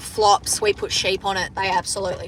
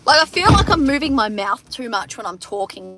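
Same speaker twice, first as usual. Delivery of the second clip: ordinary talk, nothing marked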